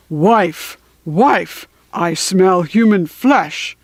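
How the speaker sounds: slow, booming giant voice; giant calling loudly. A narrator's reading. Giant calling his wife as he sniffs the air. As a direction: boom it out like a hungry giant. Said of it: "he called loudly".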